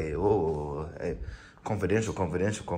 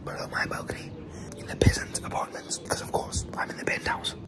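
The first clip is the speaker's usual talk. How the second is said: unvoiced secretive whisper; 8 dB quieter than usual. Real, not acted. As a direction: whisper it so they won't hear. Whispering about neighbours below.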